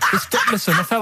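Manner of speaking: cracking up, voice leaping high; can't stop laughing before the joke; dissolving into high laughter; laughing hard. Real, not acted.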